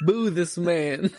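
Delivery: high, drawn-out comic boo through laughter; mock jeering. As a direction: heckle playfully, stretching each word. Mock heckling amid laughter.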